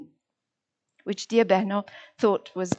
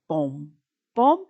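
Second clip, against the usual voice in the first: counting beats "bom"; 4 semitones lower than usual. Shown as a demonstration. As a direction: mark each syllable with a bom. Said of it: "begun with two syllables".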